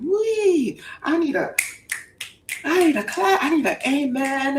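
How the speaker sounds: high whooping, shouting praise; fired-up preaching. Pretend. Preacher getting fired up calling for claps. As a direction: get the church up on its feet. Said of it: "I be getting fired up".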